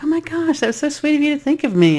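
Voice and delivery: high, gushing thanks; friend's delight. Retold or imitated. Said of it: "It was like".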